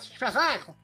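high, booming TV host catchphrase; game show host. Retold or imitated. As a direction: belt it like the Price Is Right host. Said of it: "Como é que diz o gajo lá do, do preço certo?". (apresentador do Preço Certo entusiasmado)

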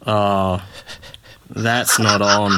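slow, prim disapproval; mild affronted bloke. Retold or imitated. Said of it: "and he just goes".

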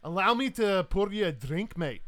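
hearty Highland host offering hospitality; Highland Scot. Acted. Scot offers the captain a drink, hearty Highland accent. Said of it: "this world's equivalent of a Highland Scot".

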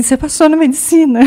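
spring the news on her triumphantly; announcing good news. Retold or imitated. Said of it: "Daí ele falou assim".

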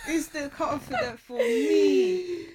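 high slow laughing drawl. Real, not acted. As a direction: savor it, cracking up. Repeating, laughing harder.

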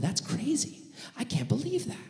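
high, soft near-whisper of amazement; acted incredulous whisper; hushed disbelief. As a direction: marvel under your breath, pitched high. Gossipers marvel in next room.

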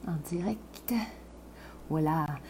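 a hushed tense cue; whispered announcement. Real, not acted. Host whispering to viewers that they are now live, hushed.

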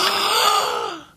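high gasp of wonder; awed gasp. Retold or imitated. Awed teen gasping at Martin Luther's words. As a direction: gasp like a starstruck fan.

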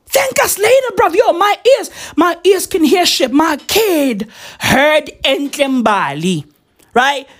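ordinary talk

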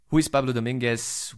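ordinary talk, nothing marked

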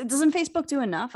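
normal talking voice

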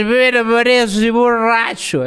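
put-on very slow, slurring drunk voice; drunk imitation. Mocking the cliché drunk, slow and slurred. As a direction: stretch every word like a sloppy drunk. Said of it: "Esa es la imitación de borracho".

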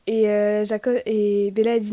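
ordinary talk

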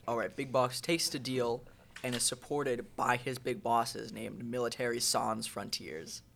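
ordinary delivery

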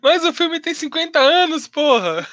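high, slow exasperated protest. Real, not acted. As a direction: drag it out in disbelief. Exasperated about spoiler complaint. (protesto exasperado e arrastado)

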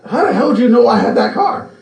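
high, baffled retelling of dad; dad's disbelief. Retold or imitated. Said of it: "And he still says that".

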